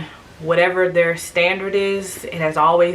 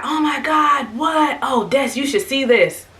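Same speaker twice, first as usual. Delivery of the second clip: higher, excited exclamation, imitating mom; amazed mom; 8 semitones higher than usual. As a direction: gasp it out like an astonished onlooker. Mom amazed watching the birth.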